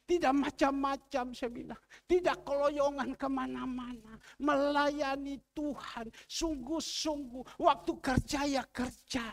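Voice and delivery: loud, high preaching at full force; fiery preaching. Acted. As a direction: preach it hard to the congregation. (khotbah berapi-api dengan suara keras dan tinggi)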